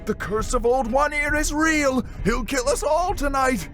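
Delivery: high, loud frantic pleading; panicking deckhand. A narrator's reading. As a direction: beg to be believed, shrieking in panic. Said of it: "The deckhand began to panic".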